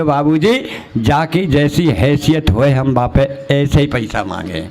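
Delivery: धीमी, गहरी, हाथ जोड़कर विनती करती भिखारी की आवाज़; "बाबूजी" को धीरे, झुककर, विनती से कहो; pleading beggar. Acted. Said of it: "हाथ जोड़ के बोले". (भिखारी का हाथ जोड़कर धीमा, गहरा अनुरोध)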